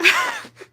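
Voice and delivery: high-pitched burst of laughter; real laugh. Real, not acted. Embarrassed storyteller breaks off her tale, high laughing. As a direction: crack up mid-sentence.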